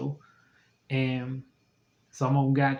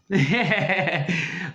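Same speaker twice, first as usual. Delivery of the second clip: laughing; 4 semitones higher than usual. Real, not acted. Laughs at God's answer.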